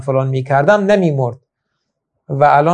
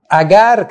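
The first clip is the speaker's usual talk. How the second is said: اگر thrown out high and loud; single out the word اگر, higher and louder; stress on اگر; 5 semitones higher, 5 dB louder, much slower than usual. Shown as a demonstration. Preacher singling out the word اگر, high and loud. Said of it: "کلمهٔ اگر".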